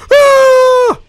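sudden piercing high shriek of fright; startled scream. Real, not acted. Gamer jumps at something in the door, shrieking. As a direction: jolt and scream at the scare. Jump scare in horror game.

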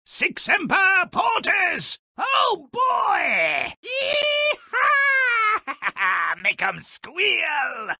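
very high, shrill-free yelling glee, cartoonish; gleeful hunting whoops. Character urging on the hunt, high excited whooping. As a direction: whoop it up like a bloodthirsty cowboy.

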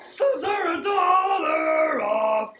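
high sung squeaky rat jingle; sewer rat voice. Retold or imitated. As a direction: sing it like a cartoon rat. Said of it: "the sewer rat commercial".

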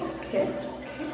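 high, slow, whispered okay okay; resigned okay. Retold or imitated. Brushed off by the busy caretaker, answering in a resigned whisper. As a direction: give in with a breathy sigh of defeat. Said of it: "I'm like".

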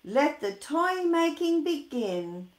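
commanding call to the elves; calling out orders. Acted. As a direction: boom it across the workshop. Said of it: "calls Santa".

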